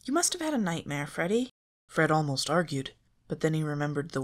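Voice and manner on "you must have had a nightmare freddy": Daphne dismissive. A narrator's reading. Daphne brushes him off.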